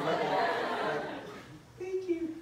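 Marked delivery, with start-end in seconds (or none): 1.8-2.3 put-on very high, breathy-light gushing girl; mock girly date voice. Daisy gushing at her dinner date, very high mock voice. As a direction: gush like a flattered date. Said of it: "I don't know if that's how you sound".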